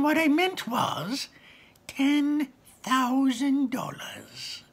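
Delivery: very high, slow sly goblin voice; scheming goblin. Acted. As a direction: gloat slowly over the price. Goblin raising price.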